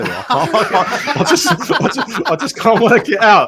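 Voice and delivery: loud, high, helpless laughing speech; laughing too hard to speak properly; cracking up. Real, not acted. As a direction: struggle to get the words out through laughter. Cracking up at friend's accent.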